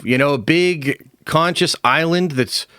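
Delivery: usual speaking voice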